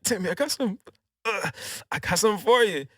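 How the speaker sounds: high, strained hurting voice. Retold or imitated. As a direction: push the line out high through mock pain. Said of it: "But he's hurt, and he's like".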